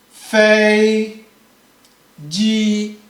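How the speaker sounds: 一声"飞机"; first-tone fei ji. Shown as a demonstration. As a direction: hold each syllable of "Fei ji" flat. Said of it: "You can see the sound is".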